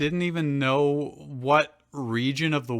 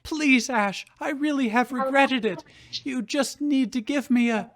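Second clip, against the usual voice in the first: higher, lightly played tearful plea; crying mother; 9 semitones higher than usual. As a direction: beg him, a hint of tears. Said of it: "Delia cried".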